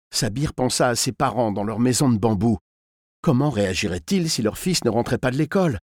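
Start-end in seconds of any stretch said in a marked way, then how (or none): none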